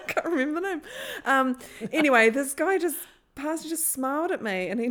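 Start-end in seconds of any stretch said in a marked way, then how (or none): 0.1-0.8 laughing. Real, not acted. Laughing mid-story.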